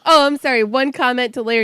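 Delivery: ordinary delivery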